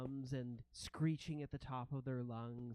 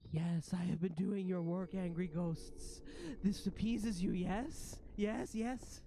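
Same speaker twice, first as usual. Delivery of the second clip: higher, louder fawning rat creature; rat prince; 5 semitones higher, 4 dB louder than usual. Acted. Rat prince eagerly reporting to the ghosts, higher creature voice. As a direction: fawn eagerly in an animal voice. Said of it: "And the rat prince says".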